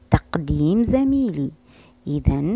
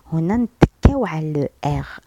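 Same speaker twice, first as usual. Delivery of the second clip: whisper. Shown as a demonstration. Teacher explaining the double R.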